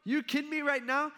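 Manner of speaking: a furious king catching someone in the act; outraged king. Retold or imitated. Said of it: "He's like".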